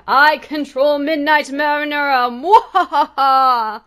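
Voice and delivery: loud gloating villain with laugh. Acted. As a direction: boom it out then cackle. Mock villain takeover.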